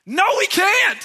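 high, explosive mock yell; exasperated shout. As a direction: burst out in comic panic at the child. Acted outburst at child's suggestion.

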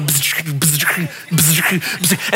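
soft, low zapping buzz; lightning buzz. Retold or imitated. Pastor mimicking childhood God's lightning, quiet buzzing. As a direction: zap them with little bolts. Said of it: "God with lightning bolts".

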